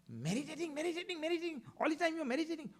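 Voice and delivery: high, loud scornful yelling; mocking shout. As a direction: ridicule meditating at the top of your voice. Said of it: "He shouted at me".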